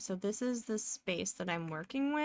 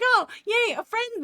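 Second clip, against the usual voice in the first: high, loud, gushing mock delight; mock delighted friend; 13 semitones higher, 8 dB louder than usual. Streamer mocking a friend who is happy to see you, high and gushing. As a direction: squeal it like a thrilled friend.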